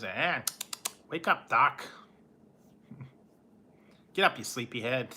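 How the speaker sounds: chirpy Bugs Bunny wake-up call. Retold or imitated. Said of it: "it was Bugs Bunny".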